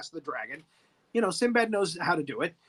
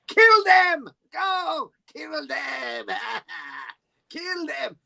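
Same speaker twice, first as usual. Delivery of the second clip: high-pitched hammed-up villain commands; cackling villain ordering an attack; Sakura; 6 semitones higher, much slower than usual. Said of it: "he goes".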